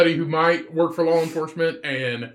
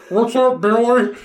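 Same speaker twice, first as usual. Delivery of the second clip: slow, slightly raised comic fat voice; 4 semitones higher, 3 dB louder, slower than usual. Acted. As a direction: put on a drawn-out fat-person voice. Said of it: "that's my fat voice".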